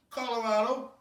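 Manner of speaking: normal talking voice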